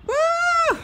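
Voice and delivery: high loud whoop; high cheer. Real, not acted. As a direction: whoop in triumph. Cheer after finishing set.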